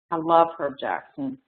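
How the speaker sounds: ordinary talk, nothing marked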